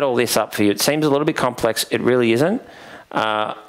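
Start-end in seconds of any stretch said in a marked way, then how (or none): none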